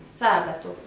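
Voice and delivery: Sabato with a long, accented SA; stress on first syllable. Shown as a demonstration. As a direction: stretch the opening SA of Sabato. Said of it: "de la première syllabe".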